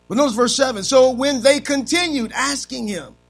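high, loud, fiery preacher cry; preaching at full force. Acted. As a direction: drive it home to the congregation, shouting. Preacher rising again.

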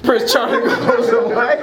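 high-pitched, laughing echo; amused disbelief. Real, not acted. Pastor repeating a listener's joke about Prince Charming, laughing in disbelief. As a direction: repeat it incredulously through laughter.

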